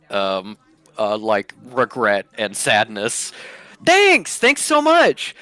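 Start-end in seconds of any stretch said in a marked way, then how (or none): 3.8-5.3 high loud forced cheer; overbright thanks. Acted. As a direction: cover the sadness with sparkle. NPC thanks brightly, hiding sadness.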